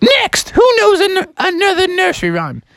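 high, impatient teacher goose; Mother Goose. Acted. Teacher moves on to the next pupil, high impatient goose. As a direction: call on the class in falsetto. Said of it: "She's like".

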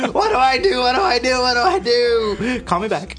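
high, loud frantic pleading; panicked. Retold or imitated. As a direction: panic into the voicemail, laughing. Retold panicked voicemail.

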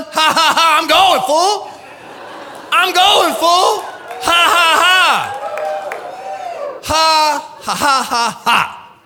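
loud, high, shouted mock laughter; laughing in defiance. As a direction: belt out HA HA right in the devil's face. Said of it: "We should laugh more in the devil's face".

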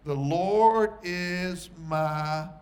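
the Lord is my stretched very slowly and loud; drawn-out emphasis. Acted. Preacher reading the verse to the congregation, slow and loud. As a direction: lean hard on 'The Lord is my' and hold it.

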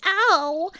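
loud, high yelp on the bump; high "ow" on the landing. A narrator's reading. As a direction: cry out as you land hard. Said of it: "as he came down".

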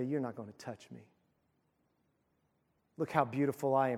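a queen who thinks she cannot be touched, low and hushed; defiant Jezebel. Acted. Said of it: "She got all dressed up to say".

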